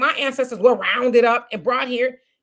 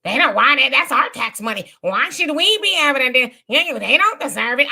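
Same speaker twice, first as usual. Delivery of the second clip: mocking dismissal; 3 semitones higher, 5 dB louder than usual. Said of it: "they were laughing at us".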